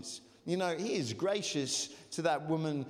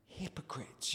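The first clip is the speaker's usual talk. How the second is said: quiet murmured response; 3 semitones lower, 9 dB quieter than usual. Real, not acted. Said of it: "Everyone say hypocrites".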